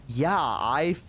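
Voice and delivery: very slow, stretched-out agreement; hesitant, drawn-out pretend sincerity; scared fake agreement. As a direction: agree reluctantly, dragging each word. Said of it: "like, a little scared now".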